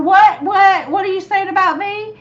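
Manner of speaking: high, loud, quick mock outrage; mock indignant surprise. Mother jumping into the livestream, hammed-up indignation. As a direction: pop in, playfully offended. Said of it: "I said".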